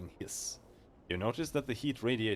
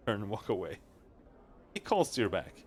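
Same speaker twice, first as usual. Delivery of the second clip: ordinary delivery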